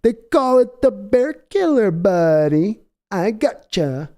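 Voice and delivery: high, slow, laid-back mock Pauly Shore; a cocky, easy surfer-dude brag; lightly hammed Pauly Shore with a crossbow; Pauly Shore impression. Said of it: "And he's like".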